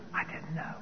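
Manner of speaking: barely audible inner thought; a quiet aside that no one hears; hushed inner confession. Retold or imitated. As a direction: whisper the secret truth to yourself. Inner confession after the answer.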